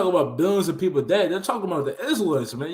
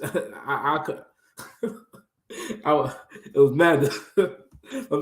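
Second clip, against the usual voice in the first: laughing; slower than usual. Real, not acted. Laughing retelling a funny video.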